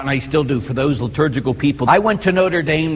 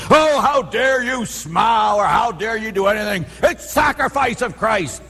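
high, shouted indignant scold; hammed-up scandalised churchgoer; mock outrage; 6 semitones higher than usual. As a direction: parody the rigid faithful yelling. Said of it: "they get crazy about the Mass".

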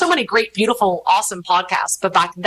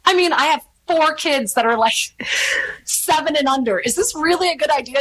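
doubtful worry; 7 semitones higher than usual. Retold or imitated. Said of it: "And I'm like".

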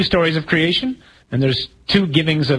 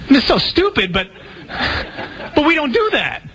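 much higher, words spilling out amused; laughing; 11 semitones higher than usual. Real, not acted. Preacher amused by his own joke, laughing as he speaks. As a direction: still giggling through it.

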